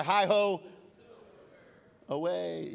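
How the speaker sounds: a loud, hammed-up Lone Ranger "Hi-ho" that sags on "away"; half-hearted mock battle cry. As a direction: belt out the cowboy cry, then let it fizzle. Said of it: "that was pathetic".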